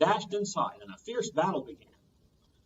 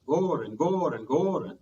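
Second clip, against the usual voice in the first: grinding sound; 5 dB louder, slower than usual. A narrator's reading.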